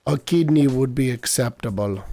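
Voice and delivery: put-on makeshift Russian doorman accent. Doorman Sergey names a kidney as payment, makeshift Russian accent. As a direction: keep up an amateur Russian accent. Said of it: "but I'm gonna keep it".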